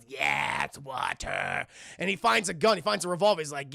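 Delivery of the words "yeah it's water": low, slow creature croak; gloating gremlin voice. Retold or imitated. Streamer as Stripe finding water, slow gloating croak. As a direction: croak it with greedy villain relish.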